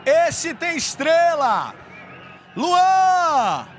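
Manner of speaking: long drawn-out goal yell; goal shout. Real, not acted. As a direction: roar the scorer's name. Goal call for Vasco. (grito de gol explosivo)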